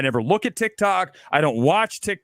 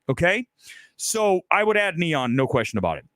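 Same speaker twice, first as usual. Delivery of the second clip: ordinary delivery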